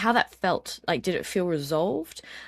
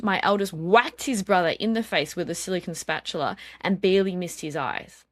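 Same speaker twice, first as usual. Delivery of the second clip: normal talking voice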